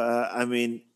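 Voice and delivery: ordinary delivery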